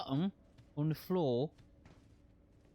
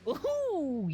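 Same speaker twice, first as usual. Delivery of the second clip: a sudden high whoop, much higher; mock cheer; 13 semitones higher, 3 dB louder than usual. Gamer to chat, a mock high whoop.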